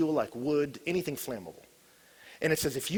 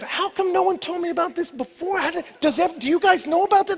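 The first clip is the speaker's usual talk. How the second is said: amazed; 13 semitones higher, 8 dB louder than usual. Acted. Amazed taster asks others.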